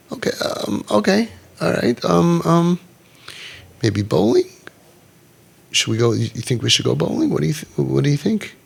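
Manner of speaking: hesitant demo, full of ums and restarts; deliberate stutter. Shown as a demonstration. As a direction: stumble gently, feeling out each suggestion.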